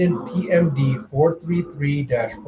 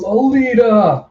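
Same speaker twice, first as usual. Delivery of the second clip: high, loud, drawn-out call; 9 semitones higher, 5 dB louder, slower than usual. Acted. Bounty hunter calling out for Alita, drawn-out shout. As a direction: holler the name long and high. Character calls out her name.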